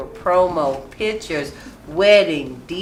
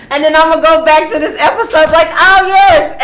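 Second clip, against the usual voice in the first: high and loud, laughing as a future pregnant self; laughing mock pregnant self; 10 semitones higher, 9 dB louder than usual. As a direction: act out your pregnant self giggling. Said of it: "I'm going".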